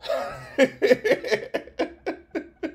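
a high airy giggle at his own joke; high breathy laugh. Real, not acted. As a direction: let out a light breathless laugh.

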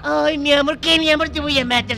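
high, heated mocking of an endearment; scornful anger. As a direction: throw the pet name back, then threaten. Retold quarrel, mocking wife's endearment. (burla airada y amenaza)

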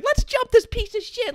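very high, slow mock kid voice; kids ganging up. Eight-year-olds lining up to beat him, high mock kid voice. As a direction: squeak it like a tough little kid. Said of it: "seven, eight-year-old kids, like".

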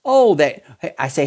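normal talking voice

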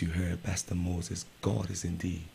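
ordinary delivery

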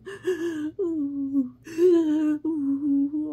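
loud, high wailing sob; dragon sob. Acted. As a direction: sob like a sad big dragon. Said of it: "the dragon sobs".

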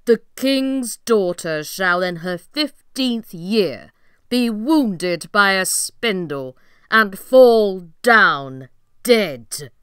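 loud, slow curse-casting cry. Acted. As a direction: proclaim the curse at full volume. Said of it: "So she cried out".

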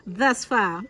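usual speaking voice

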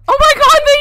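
high, loud exclamation; shocked shriek. Real, not acted. Player reacts to the human, shocked shriek. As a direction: blurt it out at full pitch.

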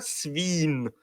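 свін with a long, drawn-out i; long vowel. Shown as a demonstration. Explaining the Scandinavian word for pig, long i in свін. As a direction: stretch the i in свін. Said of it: "з довгим «і»".

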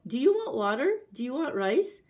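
loud, high rising questions; calling out questions. A narrator's reading. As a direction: call offers through to wife. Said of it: "he called".

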